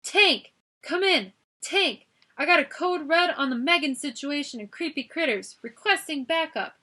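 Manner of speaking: high, loud urgent call into a walkie-talkie; urgent radio call. A narrator's reading. Guard calling for backup over the walkie-talkie, loud.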